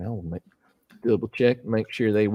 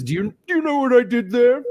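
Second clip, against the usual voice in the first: higher, put-on old gentleman voice asking a sly question; imitating Dwayne Duvall; 13 semitones higher, 5 dB louder than usual. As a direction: ape the old magician, coy and knowing. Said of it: "'Cause he kind of talked like this".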